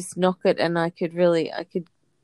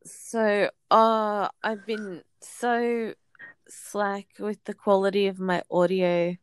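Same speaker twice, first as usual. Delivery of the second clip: ordinary talk, nothing marked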